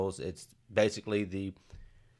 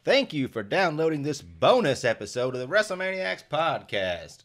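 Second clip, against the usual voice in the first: ordinary talk, nothing marked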